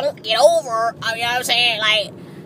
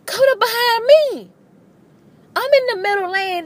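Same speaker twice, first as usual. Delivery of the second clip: alarmed cry; 13 semitones higher, 4 dB louder than usual. Real, not acted. Fire truck closing in behind.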